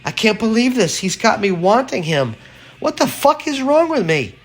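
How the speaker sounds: put-on high, disbelieving young woman; young woman's voice. A narrator's reading. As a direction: exclaim in shocked disbelief.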